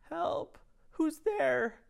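very high, small voice calling out; a tiny lost child crying for help. A narrator's reading. Trapped child calling from inside the belly, very high.

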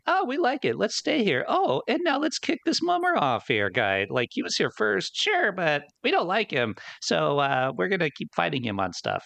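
high airy mock voice of careless invaders. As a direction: mimic smug cat aliens. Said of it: "basically are like".

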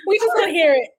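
high, laughing coaxing; laughing plea. Real, not acted. Host coaxing the guest to do voices, laughing and high. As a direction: coax her through giggles.

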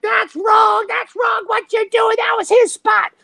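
very high-pitched mock shouting; shouting bystander. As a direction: imitate a woman yelling from across the street.

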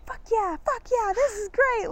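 high thrilled cheering; excited friend. Retold or imitated. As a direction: cheer the news like a delighted friend. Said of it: "she was like".

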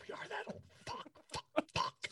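breathy whispered curses; whispered dismay. Real, not acted. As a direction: whisper it in mock horror. Dismay at being old.

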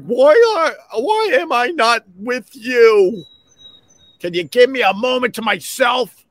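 high whiny dog voice; needy dog voice. Retold or imitated. Dog left home alone whines at its owner, high and plaintive. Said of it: "howling and barking like".

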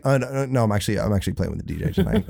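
rapid backpedalling in an everyday voice; fast, normal voice. Retold or imitated. Hastily explaining himself to security guys, really fast. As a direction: rush it out, dropping the act. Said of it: "really fast with the normal voice".

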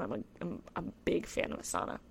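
hushed confession, nearly unvoiced; a whispered aside about loving saunas. Real, not acted. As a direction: whisper it like a little secret.

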